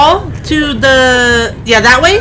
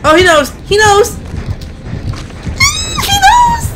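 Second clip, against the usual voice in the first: rising panic. Real, not acted. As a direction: panic climbing higher each word. Killer has spotted her.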